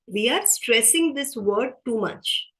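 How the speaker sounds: ordinary talk, nothing marked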